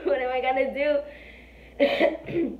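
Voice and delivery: slow, drawn out and laughing through it. Retold or imitated. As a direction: laugh at your own panic while asking. Repeats the panicked thought, laughing.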